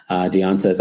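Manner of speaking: normal talking voice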